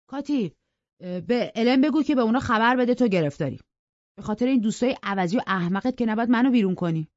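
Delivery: put-on jealous young man pleading loudly; resentful demand. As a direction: insist hard, jealous and stung. Heathcliff demands she send guests away.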